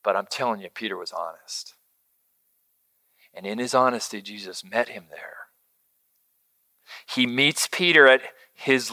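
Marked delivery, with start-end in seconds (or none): 0.0-5.5 much softer, low, nearly whispered; sincere near-whisper to the congregation; intimate hush. Real, not acted. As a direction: draw them in close and quiet. Preacher drops to intimate hush.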